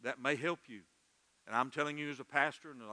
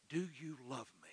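soft, intimate asking; hushed, tender question; gentle question; 2 semitones lower, 8 dB quieter than usual. Acted. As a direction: put the question quietly and let it fall. Acts Jesus asking the listener.

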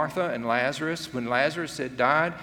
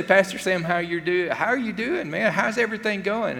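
bright, quick, higher friendly hello; cheery greeting; 6 semitones higher, 3 dB louder than usual. Retold or imitated. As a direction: greet the pastor like an eager church friend. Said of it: "And you'll say".

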